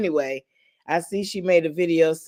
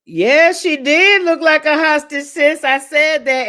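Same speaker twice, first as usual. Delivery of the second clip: higher and louder burst of agreement; excited agreement; 9 semitones higher, 8 dB louder than usual. Real, not acted. Streamer agreeing with a viewer about a video, excited burst. Laughing reaction to chat.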